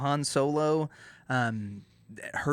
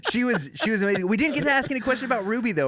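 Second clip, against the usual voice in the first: excited; 9 semitones higher, 5 dB louder, faster than usual. Real, not acted. Excited wrap-up.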